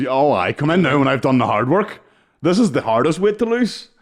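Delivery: high, loud exasperated protest; loud and high, fired up; animated loud complaint. Real, not acted. Animated complaint about weight.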